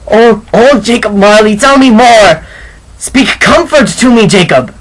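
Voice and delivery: high, loud desperate pleading; imploring. Acted. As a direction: beg him for comfort, near tears. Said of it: "he said imploringly".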